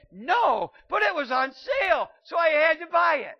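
high, loud hammed-up defence; mock shout. Shopper insisting she had to buy it, loud mock yell. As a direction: yell the excuse like a bargain addict.

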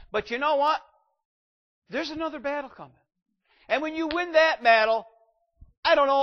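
loud, high preaching cry; full-force preaching. Acted. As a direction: preach it out to the whole congregation, rising. Preacher building to climax.

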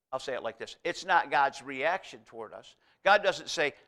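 ordinary delivery

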